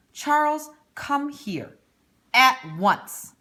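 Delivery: high, loud sharp summons across the park; stern calling out. Acted. Mother calling her son back in the park, loud and stern. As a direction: call the boy back firmly, clipped and bossy.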